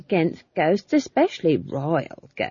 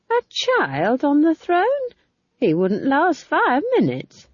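put-on high, loud indignant old gossip; indignant Nanny; 11 semitones higher, 4 dB louder than usual. A narrator's reading. Old witch complaining to friends about ghosts, indignant. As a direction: rattle off the grievances, aggrieved.

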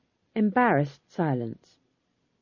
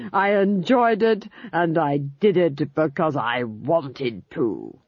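scornful Duchess; slower than usual. Acted. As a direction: sneer down at the old witch. Duchess voice held.

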